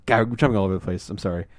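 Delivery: ordinary talk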